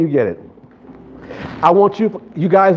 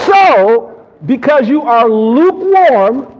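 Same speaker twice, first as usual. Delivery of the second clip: slow, raised, deliberate Bible reading; emphatic scripture reading; 6 semitones higher, 5 dB louder, much slower than usual. Real, not acted. Preacher reading the verse while congregation listens, slow and emphatic. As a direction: declaim the verse, stretching the last word.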